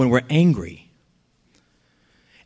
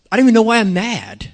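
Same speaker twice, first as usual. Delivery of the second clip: higher, quick mock exasperation; bad mood; 5 semitones higher, faster than usual. Preacher admitting to angry moods, mildly exasperated. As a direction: act out a mild grumpy outburst. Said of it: "when I'm angry in a bad mood".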